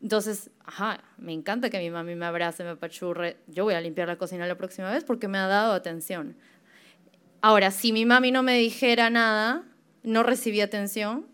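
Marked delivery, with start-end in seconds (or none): none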